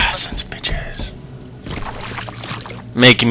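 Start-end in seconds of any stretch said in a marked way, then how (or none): 0.1-1.1 slow, breathless whispered curse; slow whispered swear. Real, not acted. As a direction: hiss it under your breath.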